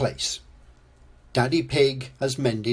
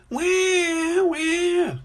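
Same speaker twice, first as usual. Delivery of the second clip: very high, loud cartoon wail; mock crying; 4 dB louder than usual. A narrator's reading. George cries over his broken dinosaur, wailing. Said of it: "cries George".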